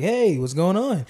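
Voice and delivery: upbeat, slowed hosting greeting; a touch of put-on podcast host cheer; podcast host energy. Shown as a demonstration. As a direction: pump it up for the show. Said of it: "I have to be like".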